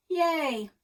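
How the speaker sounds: loud, high mock cheer; sarcastic cheer. A narrator's reading. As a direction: fake a cheer, dripping sarcasm.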